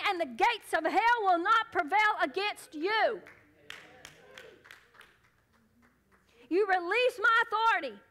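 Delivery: high, loud, rallying sermon voice; full-force preaching. Acted. Preacher telling the congregation to cast out demons, full-force preaching. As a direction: build to a forceful declaration of divine authority.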